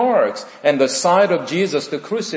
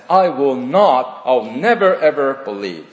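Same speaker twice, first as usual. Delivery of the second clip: louder, firm "I will not, I'll never, ever believe"; emphatic refusal; 3 dB louder than usual. Retold or imitated. Preacher voicing Thomas's refusal, loud and firm. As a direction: slam the door on it. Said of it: "Very strong, emphatic refusal".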